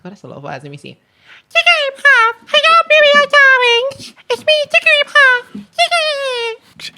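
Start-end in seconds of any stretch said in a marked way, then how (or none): 1.5-7.0 very high, loud cartoon creature squeal; sing-out cutesy mascot voice, pitched right at the top; Jigglypuff. Shown as a demonstration. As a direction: squeak every word at full volume. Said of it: "Can you do your Jigglypuff impression".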